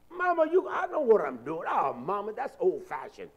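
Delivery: high, loud mock protest to a parent; exasperated know-it-all youngster, hammed up; mimicked defiant child. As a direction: play a kid brushing off mama's advice.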